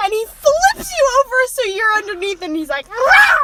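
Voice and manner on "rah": high, loud "rah"; playful growl-shout. Acted. Boy flips the girl over, playful shouted rah. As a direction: burst out with a pouncing rah.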